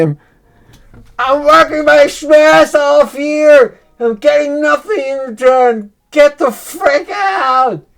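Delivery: a fed-up voter yelling at the town hall; angry shout. Acted. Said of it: "You guys say".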